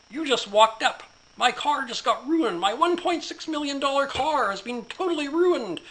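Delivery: high, loud, outraged yelling; furious shout. Acted. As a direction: threaten the officer at full volume. Businessman shouting at officer.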